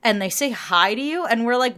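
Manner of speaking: ordinary talk, nothing marked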